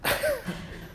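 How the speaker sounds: short high giggle; embarrassed high laugh. Real, not acted. Preacher laughing after saying crap on Easter, high giggle. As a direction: laugh at your own slip.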